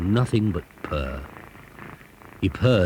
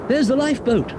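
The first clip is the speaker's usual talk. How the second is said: shouted. Acted. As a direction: shout it out across the waves. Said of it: "shouted Broomstick".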